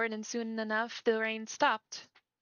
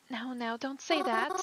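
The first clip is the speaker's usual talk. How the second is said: ordinary delivery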